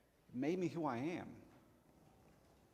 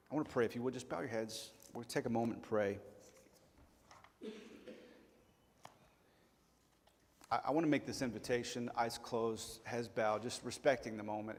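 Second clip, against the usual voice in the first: softer, gentle altar call; soft prayer invitation; 2 semitones lower, 4 dB quieter than usual. Acted. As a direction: invite them quietly to pray. Leads into prayer.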